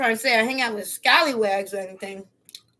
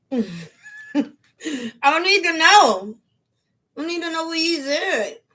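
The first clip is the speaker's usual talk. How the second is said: dismissive; 6 semitones higher, 4 dB louder than usual. Retold or imitated. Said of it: "I'd be like".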